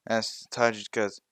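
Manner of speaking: plain reading voice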